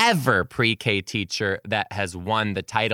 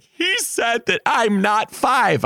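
put-on tattling kid; 11 semitones higher, 3 dB louder, slower than usual.

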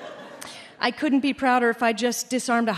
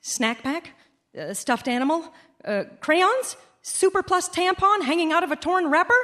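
hopeful offering; 8 semitones higher than usual. Retold or imitated. Rummaging through diaper bag hopefully.

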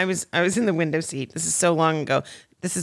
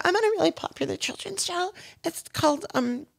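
much higher, nervous eager pitch; eager muttering; 11 semitones higher than usual. Retold or imitated. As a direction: babble to impress a star. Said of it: "I'm just muttering".

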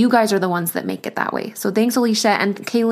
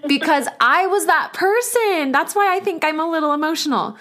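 choked up; 8 semitones higher than usual. Real, not acted. Host thanking a listener's friend, getting choked up. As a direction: voice catching as she admits it's personal. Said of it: "I'm getting a little choked up".

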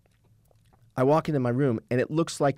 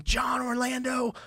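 high, drawn-out strained yell; mock screaming; 9 semitones higher, slower than usual. Woman banging on his hotel door, mock screaming. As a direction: imitate a furious scream without full volume.

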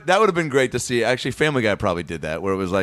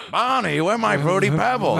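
higher cartoon yell; angry Fred; 8 semitones higher than usual. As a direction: demand the cereal back. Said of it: "he'd be like".

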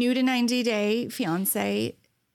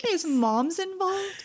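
higher, slow incredulous question; 8 semitones higher, slower than usual. Retold or imitated. Host voicing her co-host's shock at the mom. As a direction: stretch out the disbelief. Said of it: "You're like".

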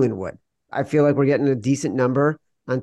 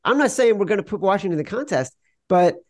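ordinary talk, nothing marked

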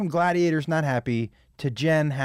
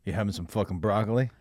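low, quick mock tough-guy jab; gruff guy voice; 7 semitones lower, 4 dB quieter, faster than usual. Guy at the restaurant mocking his plate, low gruff jab. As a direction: play the guy mocking the broccoli. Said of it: "He goes".

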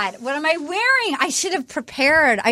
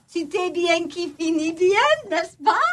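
foreign accent in French; 3 semitones higher than usual. Shown as a demonstration. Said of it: "I wanted to try to speak French".

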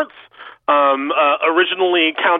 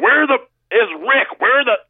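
retold scream; 8 semitones higher than usual. Son retelling his dad hunting for Rick, mock screaming. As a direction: imitate a yelling dad, half-hearted in volume. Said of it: "my dad just starts screaming".

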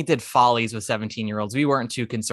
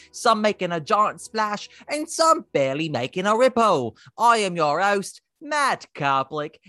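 bright, announcer-style show opening, higher; podcast host intro; 8 semitones higher than usual. Acted. Host opening the Broadway Breakdown show, announcer read. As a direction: welcome the listeners like a radio host.

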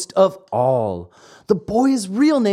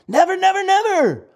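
excited high never. Acted. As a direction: squeal it out with glee. Lampwick's excited triple never.

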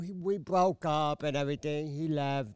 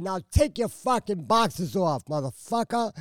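loud, higher re-enacted threat; retold angry command; 5 semitones higher, 6 dB louder than usual. Woman ordering cheating man down to nothing, retold angry command. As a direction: drive the order home, swearing at him.

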